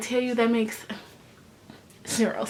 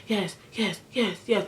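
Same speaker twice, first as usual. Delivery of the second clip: hushed gossip; 2 semitones lower, 8 dB quieter than usual. Retold or imitated. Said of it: "one by one they're like".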